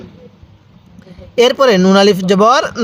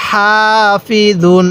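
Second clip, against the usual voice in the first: slow, syllable by syllable হাসিদিন; slow recitation; much slower than usual. Shown as a demonstration. Teacher reading the word slowly for students to copy. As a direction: draw each syllable out carefully. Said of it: "কিভাবে পড়ব?".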